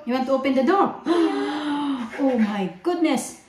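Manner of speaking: put-on playful gasp at the child's complaint; mock surprise. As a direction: gasp along in fake shock. Mother mock-gasps at child's complaint. (kunwaring gulat)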